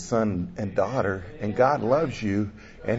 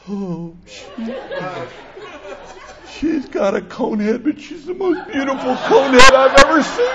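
higher and louder, laughing through the words; laughing adoringly; 12 semitones higher, 8 dB louder than usual. Retold or imitated. Grandpa laughing about his newborn granddaughter's cone head, delighted and loud. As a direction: gush over the baby while laughing. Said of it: "And I'm like".

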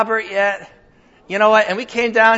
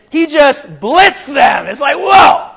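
shouted, higher and louder; excited shout; 7 semitones higher, 8 dB louder, slower than usual. Real, not acted. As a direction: blast it out like a preacher's exclamation. Preacher exclaims about the apostle.

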